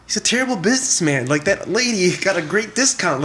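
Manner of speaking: put-on high, excited young boy complaining; a boy puzzled by his father; kid's voice. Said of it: "he's like".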